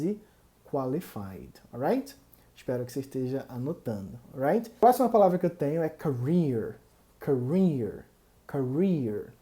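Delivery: ordinary talk, nothing marked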